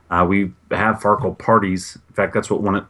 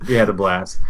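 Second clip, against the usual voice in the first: ordinary delivery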